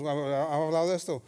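ordinary talk

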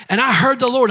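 usual speaking voice

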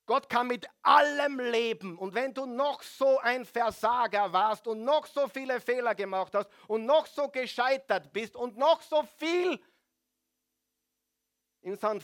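loud, high, ringing proclamation; booming pulpit declaration; full-force preaching. Acted. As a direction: preach it out with fire.

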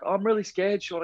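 put-on a small boy afraid to jump into the pool; scared little boy. Scared four-year-old boy in pool.

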